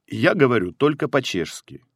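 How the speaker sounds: ordinary talk, nothing marked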